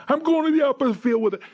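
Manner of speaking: high, loud held-up yell; imitating a batter's excited shout; shouted mid-play. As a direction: holler it out on the move. Batter shouts while hitting and running.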